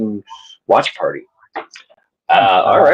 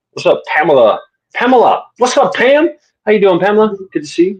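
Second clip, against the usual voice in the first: excited greeting; 9 semitones higher than usual. Real, not acted. Greeting a friend in a game.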